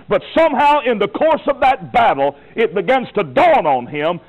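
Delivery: high, rising preacher's cry; fiery preaching. Acted. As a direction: preach it with mounting fire. Preaching at full force.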